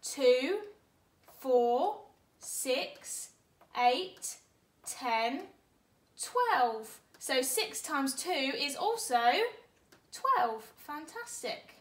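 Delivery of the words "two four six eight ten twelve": counting in twos. Shown as a demonstration. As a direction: chant each number loudly with a beat.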